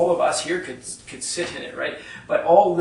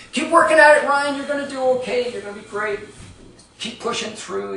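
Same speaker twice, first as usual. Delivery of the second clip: bright, much higher cheerleading call that settles down; mock cheering on; 8 semitones higher, 7 dB louder than usual. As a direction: cheer him on like an eager youth group. Group encouraging stuck friend.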